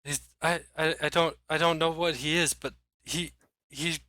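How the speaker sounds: high, faltering, halting voice; fearful stammering. Acted. Rattled mayor trying to explain who hunts him, fearful stammer. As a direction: struggle to get the words out, rattled.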